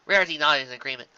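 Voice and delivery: ordinary delivery, nothing marked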